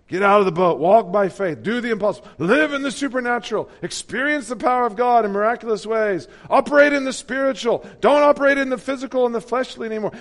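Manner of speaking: loud, high mocking preacher voice; prosperity preacher lingo. Mocking generic faith preachers' lingo, loud and high. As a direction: parody the hype sermon. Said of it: "same lingo".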